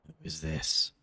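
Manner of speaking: low, soft guard question; gruff guard. Acted. Guard questioning the captain, low and soft. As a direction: ask it low and quiet.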